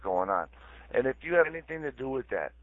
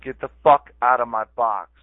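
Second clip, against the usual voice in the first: angry order; 2 semitones higher, 10 dB louder than usual. Real, not acted. Repeats order angrily.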